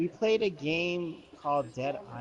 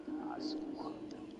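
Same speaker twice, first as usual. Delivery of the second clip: whispered, slow "come on"; whispered retort; 11 dB quieter than usual. Retold or imitated. Kid quietly grumbling back at the bossy friend, whispered. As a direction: breathe it out under your breath, fed up.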